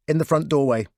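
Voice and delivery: ordinary delivery, nothing marked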